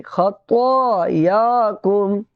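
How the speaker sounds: खतायाकुम slowly chanted with long "aa" sounds; stretched vowels. Shown as a demonstration. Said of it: "खींच करके बोलेंगे". (खतायाकुम खींच करके)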